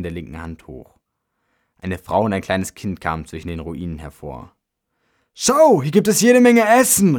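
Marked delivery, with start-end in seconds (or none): 5.3-7.2 high, loud shout to someone far off; excited, full-voiced holler; calling out. A narrator's reading. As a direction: call the good news over to your wife. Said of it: "rief der Mann seiner Frau entgegen".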